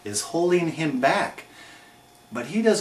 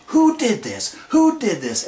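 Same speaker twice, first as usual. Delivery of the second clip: higher-pitched mock outraged questioning; mock rampage demand; 8 semitones higher than usual. Wrestler hunting whoever attacked his partner, mock outrage. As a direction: demand it like an imitated angry wrestler.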